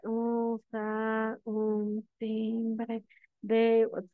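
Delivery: very slow, droning monotone demo; sleep-inducing reading. Shown as a demonstration. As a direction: read it painfully slowly, dragging every word. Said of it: "Se durmieron todos, nadie escuchó absolutamente nada".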